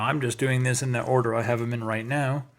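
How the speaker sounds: ordinary delivery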